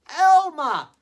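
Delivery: high, loud call of the name; delighted recognition. Acted. Elephants recognise Elmer.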